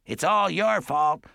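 high, slow accusation; accusing patient. A narrator's reading. Wild-haired man at the window blames the boy, accusing.

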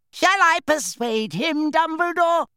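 high, slow witch voice, calling out; a sharp-eyed witch offering menace. A narrator's reading. Witch calling to Dumbledore, high and threatening. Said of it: "called a gimlet-eyed witch".

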